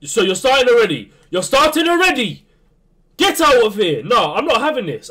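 high, loud, disbelieving protest; outraged shout. Real, not acted. Gamer protesting the opponent's start, loud indignant shout.

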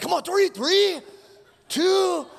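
high, loud coaching shout; barked gym encouragement; spotter yell. Retold or imitated. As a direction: push him like a gym spotter. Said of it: "he's like".

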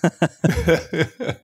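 short laugh at own joke. Real, not acted. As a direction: chuckle.

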